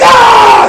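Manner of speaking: sudden high, loud cry of alarm; panicked shout. Retold or imitated. Teacher acting startled militia, high loud cry. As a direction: yelp like you're under attack. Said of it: "You're like".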